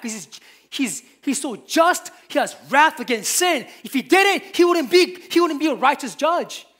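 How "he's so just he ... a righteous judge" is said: loud, rising preacher's cry, driving each phrase home; full-force preaching. Acted. Pastor rebuking false believers, in a loud full-force preaching cry. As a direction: preach it hard and loud to the congregation.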